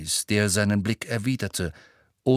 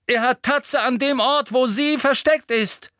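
much higher, louder cry; urgent call; 14 semitones higher, 6 dB louder than usual. A narrator's reading. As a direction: shout after Snape before he leaves. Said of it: "rief er". (verzweifelt gerufen)

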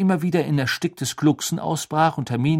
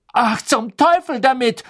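higher, louder, exasperated cry; loud outburst; 13 semitones higher, 8 dB louder than usual. A narrator's reading. Hermine losing patience with Trelawney, loud exasperated outburst. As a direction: blurt it out fed up. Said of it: "sagte Hermine laut".

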